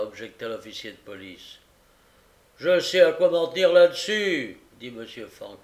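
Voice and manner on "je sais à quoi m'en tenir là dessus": loud, high magistrate; overbearing retort; dismissive retort. A narrator's reading. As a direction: overrule the objection loudly. Magistrate rejects officer's objection.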